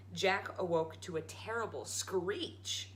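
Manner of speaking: ordinary delivery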